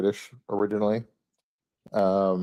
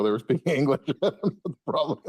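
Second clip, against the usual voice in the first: higher voice breaking into laughter; laughing; 8 semitones higher, faster than usual. Real, not acted. Son retelling his reply to confused dad, laughing. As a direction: laugh through the punchline.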